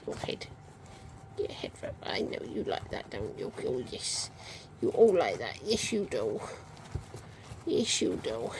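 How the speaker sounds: soft breathy whisper to pups; whispering to puppies. Real, not acted. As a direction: hush and coo at the puppies.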